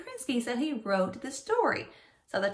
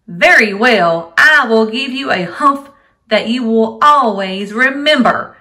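genie yelling; 17 dB louder than usual. Acted. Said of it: "the genie yelled".

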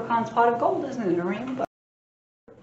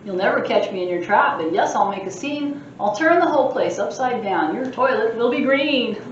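a lightly voiced mischievous leprechaun boasting; cheeky leprechaun; 4 semitones higher, 7 dB louder than usual. Librarian reading the leprechaun's boasts aloud, cheeky. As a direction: tease the reader like a sprite.

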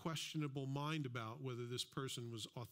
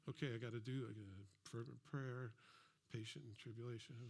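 checking off chores, softer and a little lower; to-do list mockery; 3 semitones lower, 6 dB quieter than usual. Preacher mocks believers ticking off scripture as chores, softer. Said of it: "It just sounds like a to-do list".

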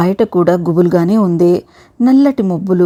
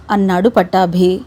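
usual speaking voice

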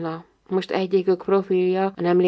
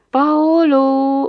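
higher, louder, drawn-out small voice; little child musing; 6 semitones higher, 6 dB louder than usual. A narrator's reading. Little one musing over the name, small dreamy voice. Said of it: "merengett el a kicsi hangocskája".

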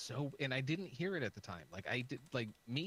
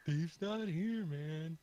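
slow, higher lazy stoner drawl; 5 semitones higher, 4 dB louder, much slower than usual. Acted. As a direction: hang on each word like a stoner. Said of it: "It's from [name] and [name]".

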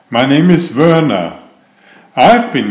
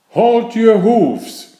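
high, slow commanding cry; 9 semitones higher, slower than usual. Acted. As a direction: call a halt, high and drawn out. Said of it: "cried an elf".

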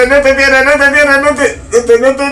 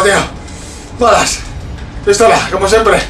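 usual speaking voice

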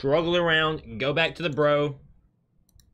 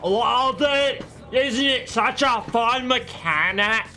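high, loud, slow mocking drawl; mock the mechanic with heavy sarcasm; sarcastic complaint; 10 semitones higher, 4 dB louder, slower than usual. Real, not acted. Gamer mocking the game's blinding stun, sarcastic.

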